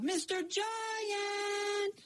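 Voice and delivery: high, slow, drawn-out call; yelling. Acted. Jack calling out to the giant, long high yell. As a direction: call him from across the field. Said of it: "and he yelled".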